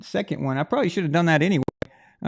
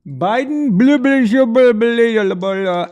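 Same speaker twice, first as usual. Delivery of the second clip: high, loud mock babble; babbling gibberish; 6 semitones higher, 7 dB louder, slower than usual. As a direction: garble it into loud nonsense syllables. Garbles a name into loud gibberish.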